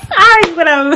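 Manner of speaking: high, loud cackling; real uncontrolled laughing fit; helpless laughter. Real, not acted. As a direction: try to apologise through shrieking laughs. Laughing too hard to continue.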